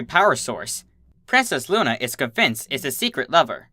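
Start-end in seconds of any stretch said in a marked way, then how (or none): none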